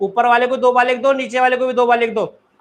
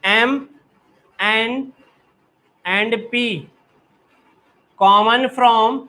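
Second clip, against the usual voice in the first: slow dictation; much slower than usual. Shown as a demonstration. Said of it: "teacher dictating phrase".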